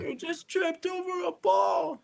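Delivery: high, slow hammed-up gasp of alarm; mocking the fussing grown-up; mock panicked adult. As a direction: overreact in a shrill mock panic. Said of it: "if he sees me".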